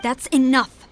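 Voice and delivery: loud, cutting rebuke; firm angry command; stern rebuke. Acted. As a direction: shut the excuses down hard.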